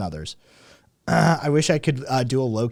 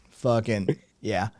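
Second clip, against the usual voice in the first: normal talking voice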